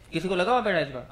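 ordinary talk, nothing marked

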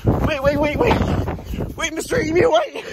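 high, repeated calls to stop; urgent calling. Real, not acted. Man chasing escaped emu, urgently calling out. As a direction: plead with a runaway to wait.

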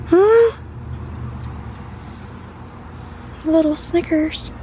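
cooing high pet voice; pet talk. Real, not acted. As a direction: squeak it up for the kitty. Talking to the cat.

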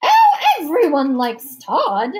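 loud, high, slow society lady; hammed-up matronly matchmaker; scheming matchmaker hostess. A narrator's reading. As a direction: gush it in drawn-out theatrical tones. Hostess playing matchmaker at dinner.

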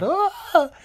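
high-pitched startled "ah" cry; sudden high yelp, acted in a retelling; mock yell. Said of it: "Izuku just yells out".

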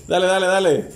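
ordinary talk

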